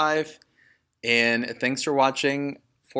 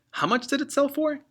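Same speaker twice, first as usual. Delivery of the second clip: much higher eager question; amazed. Acted. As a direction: gasp it out in wonder. Son amazed at sale.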